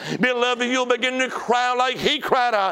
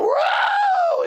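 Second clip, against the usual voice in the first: a shouted, very high whoa; sudden whoa; 3 dB louder than usual. Acted. Acting sudden halt.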